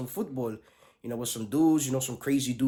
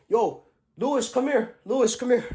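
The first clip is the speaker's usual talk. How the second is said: calling out; 9 semitones higher than usual. Retold or imitated. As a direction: call him over. Amanda calling out.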